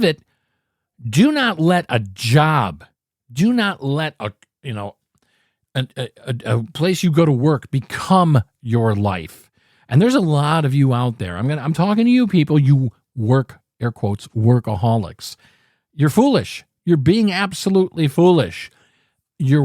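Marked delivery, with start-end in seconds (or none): none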